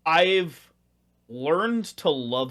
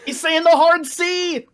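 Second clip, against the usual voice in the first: loud, high-pitched yell of alarm; mock-scandalized shout; 14 semitones higher, 11 dB louder than usual. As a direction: call it out in mock outrage. Mock-scandalized outburst at friend.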